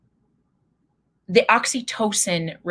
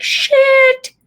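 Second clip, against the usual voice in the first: high, loud "Shit" after a bad autocorrect; squealed mock dismay; mock panicked curse; 11 dB louder than usual. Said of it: "you're like".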